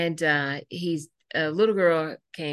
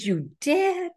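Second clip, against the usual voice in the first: delighted surprise. Retold or imitated. Teacher delighted by news.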